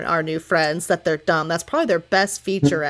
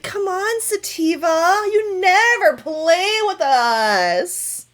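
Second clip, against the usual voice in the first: higher, slow whining plea; whiny mermaid; 9 semitones higher, much slower than usual. Acted. A merfolk whines to Sativa for playing with them. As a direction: whine like a sulky child. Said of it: "She's like".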